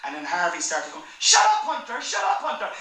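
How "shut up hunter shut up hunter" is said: loud, higher-pitched sibling outburst; mock yell of an irritated little boy; child's angry yell. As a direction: imitate a kid shouting down his brother. Child yelling at brother, retold.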